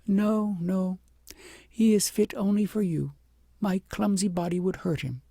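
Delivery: humble princess. A narrator's reading. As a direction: she feels unworthy of the pony.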